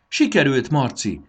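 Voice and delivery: bright, loud and high with joy; gleeful shout of relief; excited cry. A narrator's reading. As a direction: burst out in delight. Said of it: "lelkendezett Fanni".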